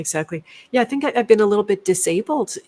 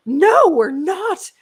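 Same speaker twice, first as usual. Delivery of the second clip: imitated indignant teller; 11 semitones higher, 5 dB louder than usual. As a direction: mimic an affronted teller, pitched way up. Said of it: "Like that".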